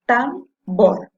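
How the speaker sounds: tambor as two separate loud beats, tam, bor; split into syllables. Shown as a demonstration. Teacher dividing a word for pupils, tambor syllable by syllable. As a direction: break tambor in two with a clear gap. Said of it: "Si yo la separo en sílabas".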